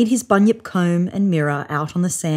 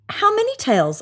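eager question; 13 semitones higher than usual. A narrator's reading.

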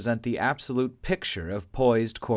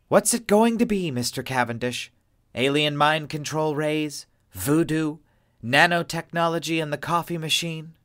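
higher, louder mocking list; sarcastic; 6 semitones higher, 4 dB louder than usual. A narrator's reading. Adele sarcastically mocking Cavendish, higher louder scorn. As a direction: ridicule his excuse.

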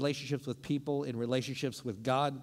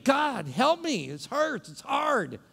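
slow, higher, louder pleading to God; desperate prayer; 10 semitones higher, 8 dB louder, slower than usual. Acted. Preacher voicing a hurting person, pleading loud. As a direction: cry out to God for help. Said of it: "you've been praying".

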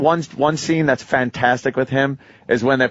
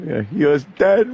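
normal talking voice

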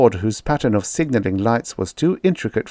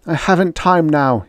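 plain reading voice

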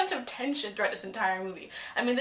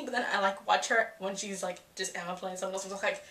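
normal talking voice

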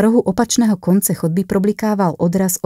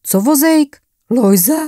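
confused, high blurt; blurted surprise; 8 semitones higher, 4 dB louder, slower than usual. A narrator's reading. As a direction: spit it out, caught off guard. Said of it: "vyhrkl ze sebe překvapeně".

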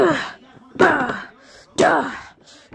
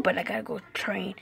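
whispered aside to yourself; 5 semitones lower, 12 dB quieter than usual. Acted. Fighter whispering plans to himself before bed, hushed. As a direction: say it under your breath.

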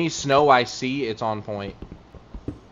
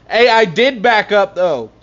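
protesting; 7 semitones higher, 8 dB louder than usual. Real, not acted. Protesting to chat.

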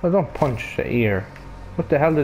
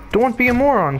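scolding; 10 semitones higher, 3 dB louder than usual. Real, not acted. Scolding the character.

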